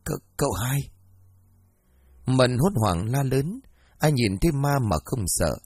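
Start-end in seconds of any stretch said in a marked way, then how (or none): 0.1-0.8 stuttered first sound, timidly voiced; frightened stammer. A narrator's reading. Mận seeing the dead second master, frightened stammer. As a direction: stumble on the name in mild shock. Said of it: "Mận lắp bắp nói không thành lời".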